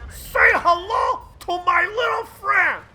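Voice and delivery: high, slow hammed-up gangster line; movie quote shout. Commentator quoting Scarface after the kill, hammed shout. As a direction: deliver it like a movie villain.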